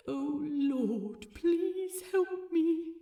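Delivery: hammed-up flustered appeal, slow and high; flustered plea. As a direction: beg heaven for help, comically overwhelmed. Flustered plea after touch.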